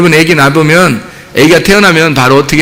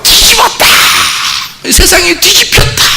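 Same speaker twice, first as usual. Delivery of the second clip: 뒤집었다 cried out much higher in delight; overjoyed parent; 11 semitones higher than usual. Retold or imitated. Preacher imitating a parent crying 뒤집었다 much higher in joy. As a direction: shriek 뒤집었다 like the world just turned over. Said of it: "부모의 그 마음이 막".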